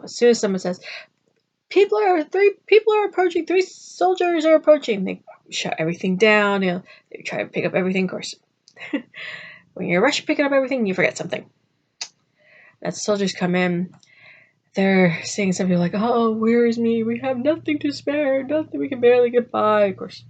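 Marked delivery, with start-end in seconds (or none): 1.7-5.0 higher, urgent lookout call; alarmed call. Retold or imitated. Villager warning everyone that soldiers are coming, urgent raised call. 15.9-19.9 higher, put-on pleading poor voice; poor villager. Villager pretending to soldiers they have nothing, mock pleading.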